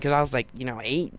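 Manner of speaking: ordinary talk, nothing marked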